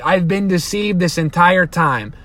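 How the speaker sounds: ordinary talk, nothing marked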